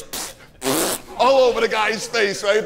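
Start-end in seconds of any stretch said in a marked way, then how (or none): 0.0-1.0 spitting pfft sounds; ketchup squirt noises. Retold or imitated. Squirting ketchup on the biker, pfft noises. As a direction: puff the ketchup squirts slowly. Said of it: "he squishes it, goes".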